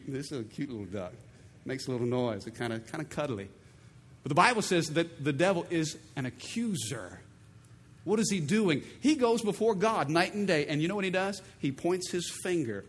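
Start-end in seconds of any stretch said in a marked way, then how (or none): none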